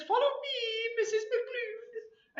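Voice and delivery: very high, drawn-out comic husband; eager storybook husband. Acted. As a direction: call the wife along in a high-pitched voice.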